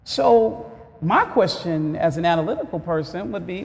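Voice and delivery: ordinary delivery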